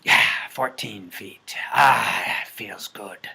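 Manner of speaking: breathy whispered sigh of satisfaction; whispering to yourself after getting it right; satisfied whisper. Pretend. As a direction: whisper it like a small relief. Satisfied after solving a problem.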